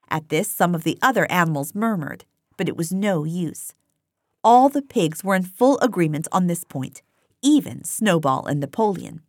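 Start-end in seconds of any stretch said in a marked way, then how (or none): none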